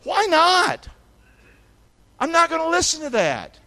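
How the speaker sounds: high, loud sharp shut-down; shutting down gossip. Shown as a demonstration. Preacher showing how to answer a gossiper, loud and high. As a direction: cut the gossiper off hard. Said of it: "Here's two good words".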